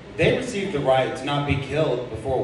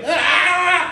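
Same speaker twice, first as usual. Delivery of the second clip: high scared shriek; hammed-up panicked scream; 6 dB louder than usual. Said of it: "And I just, like".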